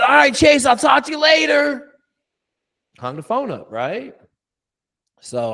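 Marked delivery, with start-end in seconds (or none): 0.0-1.9 high, loud cheery sign-off; loud breezy goodbye. Retold or imitated. Coach hanging up on Chase, loud and high. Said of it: "I said".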